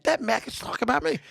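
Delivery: high, lightly voiced lisping guy; a light take on Calvin; Calvin impression. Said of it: "[name] does a good Calvin impersonation".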